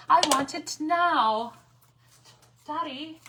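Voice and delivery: hammed-up bratty child whining for Daddy; Veruca Salt impression. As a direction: stamp your foot like a spoiled rich girl. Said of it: "Yes, Veruca".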